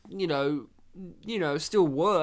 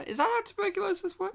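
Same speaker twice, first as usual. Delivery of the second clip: mock disbelief; faster than usual. Mock incredulous question.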